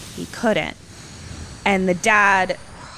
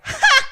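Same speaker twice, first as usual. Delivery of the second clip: put-on sudden high loud ha of a kid; mock kid laugh; 5 dB louder than usual. Retelling the kid laughing at his dad, sudden high ha. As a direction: bark one high laugh. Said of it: "the kid was just like".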